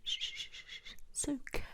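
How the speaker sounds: calm a tearful patient; tender, comforting shushing; soothing hush. Acted. Nurse hushes crying patient.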